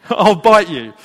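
loud, amused re-quote through laughter; laughing repeat. Retold or imitated. Preacher relishes lady's threat to his dad, laughing repeat. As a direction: repeat the mock threat loudly, cracking up.

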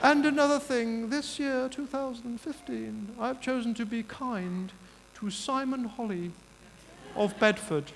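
high, measured mock royal announcement; slow down regally, softening after the opening; the Queen. Queen announcing her chosen subject in a speech, regal. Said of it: "this is my Queen impression".

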